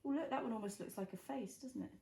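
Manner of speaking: usual speaking voice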